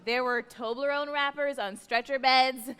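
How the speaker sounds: normal talking voice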